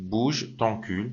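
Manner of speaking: slow careful French; teacher modelling the French; French model phrase. Shown as a demonstration. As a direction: say it clearly for learners. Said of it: "دقیقاً تو فرانسه داریم".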